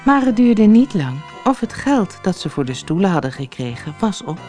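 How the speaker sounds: usual speaking voice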